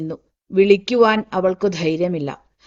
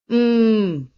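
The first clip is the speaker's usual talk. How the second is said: long hum; 2 semitones higher than usual. A narrator's reading. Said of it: "പുറത്ത് അങ്ങനെയൊരു നീണ്ട മൂളൽ".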